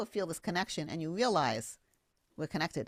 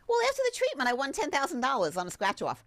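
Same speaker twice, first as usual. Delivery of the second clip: client's news; 7 semitones higher, 4 dB louder than usual. Retold or imitated. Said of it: "client quoted".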